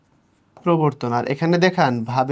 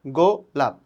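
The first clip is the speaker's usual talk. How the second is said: গো লাপ with a clear gap between the two syllables; split into syllables. Shown as a demonstration. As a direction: say গো, pause, then লাপ. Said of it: "একটা গো, একটা লাপ". (গো আর লাপ আলাদা করে, মাঝে থেমে বলা)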